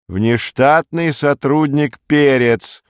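secretary calling out a name, stretched and insistent. A narrator's reading. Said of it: "повысив голос". (повысив голос, протяжно и официально)

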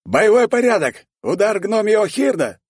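high, loud battle order; commander's high-pitched yell; shouted command. A narrator's reading. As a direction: shout the formation order to the troops. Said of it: "выкрикнул команду тысячник".

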